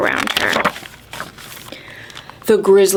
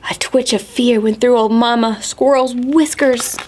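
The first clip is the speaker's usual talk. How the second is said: ordinary delivery, nothing marked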